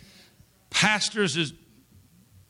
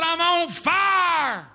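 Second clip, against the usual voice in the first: very slow, high, stretched-out shout; retold anguished shout; 12 semitones higher, 3 dB louder, much slower than usual. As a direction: wail it as if burning. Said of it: "He said".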